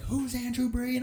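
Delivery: high-pitched imitation of a manager calling out; frantic manager. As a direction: call out high and drawn-out, searching for someone. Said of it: "His manager comes running".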